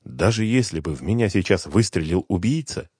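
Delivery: ordinary delivery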